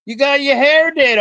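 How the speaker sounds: exaggerated Southern gushing. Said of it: "enough Southern accents here".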